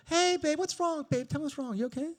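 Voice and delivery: put-on high, coaxing girlfriend voice; concerned girlfriend. Girlfriend testing how her man sounds, high mock concern.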